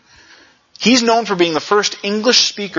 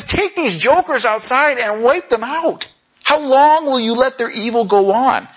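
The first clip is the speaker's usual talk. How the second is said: a raised, indignant plea, higher pitched; upset prophet; 8 semitones higher than usual. Acted. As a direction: demand it with building indignation. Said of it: "He's upset".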